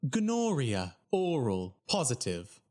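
robotic Siri-like readout, each word separate; automated phone voice. Retold or imitated. Results hotline listing STD results, stilted automated voice. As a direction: recite each item with the same falling tune. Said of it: "imagine Siri giving you your S T D results".

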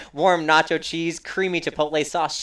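ordinary delivery

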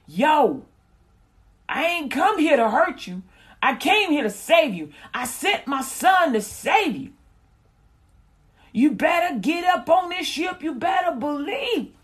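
high, loud, hyped-up call to get on board; hammed-up pleading God, shouting; God as a loud street preacher begging you to come; exaggerated God voice. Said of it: "He ain't say it like that. I'm just exaggerating".

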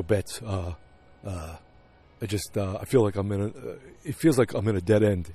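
halting, softened husband impression; lightly voiced, low-key and hesitant; Henry Francis. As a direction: play a subdued husband, groping for words. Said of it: "This is my best Henry Francis yet".

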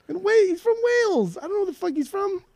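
very high, bewildered rant. Real, not acted. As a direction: squeal it out mid-rant. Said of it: "will appreciate that rant".

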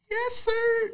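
high cracking squeak on sir; puberty voice cracking upward. Retold or imitated. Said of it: "You be like".

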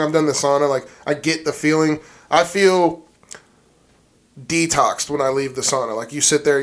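ordinary talk

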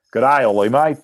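loud, broad Australian phone greeting; Australian accent. Retold or imitated. Australian coach ringing a player out of the blue, in a hammed-up Australian accent. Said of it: "an Australian accent on the phone".

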